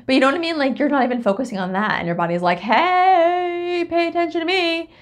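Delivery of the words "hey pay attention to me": high, loud, drawn-out "hey" call; put-on high voice clamoring to be noticed; attention-seeking body. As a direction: playfully demand attention in a high sing-out voice.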